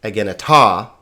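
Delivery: "egeneta" with "ta" hit hard; misplaced final-syllable stress. Shown as a demonstration. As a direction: say e-gen-e-TA, punching the last syllable. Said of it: "not egeneta".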